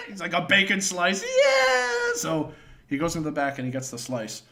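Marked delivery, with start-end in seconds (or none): 0.3-2.1 put-on high whiny kid voice rising to a wail; hammed-up child on the verge of tears; mock crying kid. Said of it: "the kid was about to cry".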